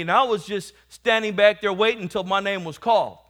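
loud preacher rolling through a story; preaching. Acted. Preacher telling the church about testimony night, forceful preaching.

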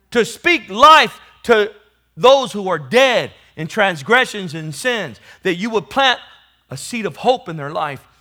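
loud, high, ringing preaching climax; full-force preaching. Acted. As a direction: preach it out loud to the whole church.

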